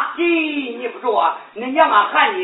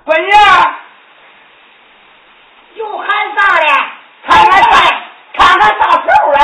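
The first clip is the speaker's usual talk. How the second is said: loud calling; 6 semitones higher, 9 dB louder, faster than usual. Acted. Mother calling daughter to get up.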